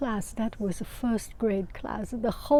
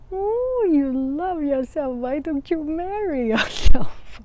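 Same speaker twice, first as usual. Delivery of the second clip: put-on high, loud, cheeky child's voice; little girl teasing; 6 semitones higher, 7 dB louder than usual. Little girl teasing her teacher, high and loud. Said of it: "And she said".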